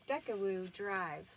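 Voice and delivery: ordinary delivery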